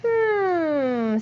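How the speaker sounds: drawn-out pondering hmm; thinking hum. Acted. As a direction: hum like you're thinking it over. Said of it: "The fox is thinking".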